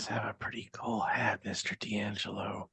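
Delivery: breathy whispered compliment. Acted. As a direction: lean in and whisper it. Whispered aside to the gunslinger.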